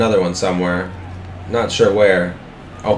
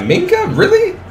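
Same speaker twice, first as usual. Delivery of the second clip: leaps way up in pitch, incredulous; can't believe what he just saw; stunned disbelief. Real, not acted. Disbelief at a blown coverage.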